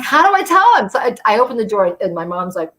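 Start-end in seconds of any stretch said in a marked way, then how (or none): 0.0-0.9 high, loud burst of panic; panicked. Retold or imitated. Daughter panicking about telling her husband, high and loud. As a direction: blurt it out in a panic.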